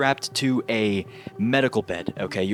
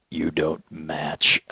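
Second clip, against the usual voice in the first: creepy doctor voice; 5 semitones lower, much slower than usual. Retold or imitated. Doctor voiced in movie recap.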